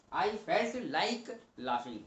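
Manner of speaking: very slow, word by word; slow dictation. Shown as a demonstration. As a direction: lay each word down slowly for students. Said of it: "teacher repeating example sentence".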